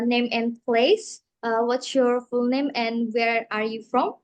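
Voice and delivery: ordinary delivery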